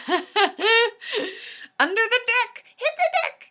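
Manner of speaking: high, drawn-out laughing alarm; high slow laughing hit the deck; laughing mock alarm. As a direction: cry out high through laughter, playing scared. Hiding from cannon, laughing.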